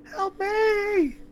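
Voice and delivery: very high, stretched-out plea; trapped victim's cry for help. Acted. Game master voices someone trapped underground, high desperate cry. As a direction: cry out high, as if from inside a tank.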